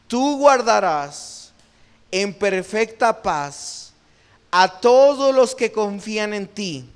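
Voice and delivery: slow, loud Bible verse; measured, forceful reading to a congregation; scripture recitation. Real, not acted. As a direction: proclaim the verse phrase by phrase.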